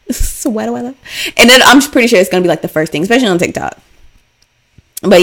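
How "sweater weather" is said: softer, higher mimicked catchphrase; amused echo of the comedy skit; skit-style catchphrase. Telling listeners what to type in, playful skit voice.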